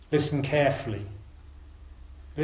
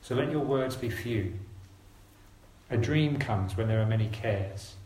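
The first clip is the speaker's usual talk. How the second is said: slow scripture reading; 2 semitones lower than usual. Real, not acted. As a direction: read slowly and deliberately, with long pauses. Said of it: "I'm gonna read it slowly".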